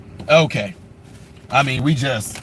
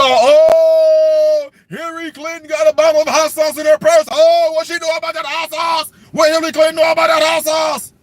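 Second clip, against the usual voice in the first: hammed-up excited shouting; mock crowd hype; 5 semitones higher than usual. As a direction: hype up the crowd like it's church. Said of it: "we are".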